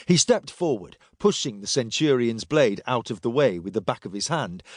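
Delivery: usual speaking voice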